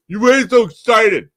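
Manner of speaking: mock the killjoy who downplays the win; put-on voice of a dismissive coworker; generic annoying person voice. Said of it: "That's my generic voice for people I don't like".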